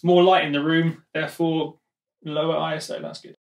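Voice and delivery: normal talking voice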